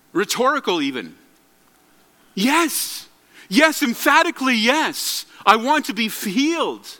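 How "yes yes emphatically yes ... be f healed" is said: higher-pitched, emphatic imagined answer; emphatic yes. Acted. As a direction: answer with an eager, forceful yes. Acting the obvious eager answer.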